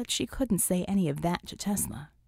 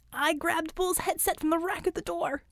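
high, triumphant report in a voice unlike the narrator's; 9 semitones higher than usual. A narrator's reading. Acrobat proudly telling the team he grabbed the headset, high and triumphant. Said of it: "Acrobat said in triumph".

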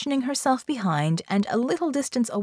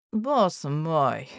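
put-on weary, accented team leader; 3 semitones lower than usual. A narrator's reading. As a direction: sigh it out with mild weariness. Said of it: "Molotok said, wearily".

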